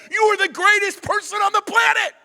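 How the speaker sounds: loud, high-pitched gushing excitement; overjoyed dog. Acted. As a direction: shout it like a dog adoring its owner. Voicing an adoring dog's delight.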